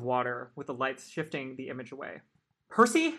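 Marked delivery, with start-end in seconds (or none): 2.7-3.1 high, loud call with a cracking voice. Acted. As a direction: let the voice crack in fear. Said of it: "Annabeth's voice cracked".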